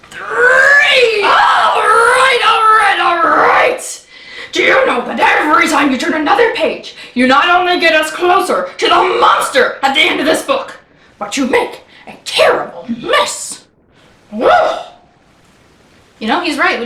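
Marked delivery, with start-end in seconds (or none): 0.1-1.2 high, drawn-out shout; counting off excitedly. Pretend. Librarian heaves the page over with children, excited shout. 1.2-14.9 high wail settling into a scolding; Grover, exasperated. Acted. Grover scolds reader about the mess, exasperated.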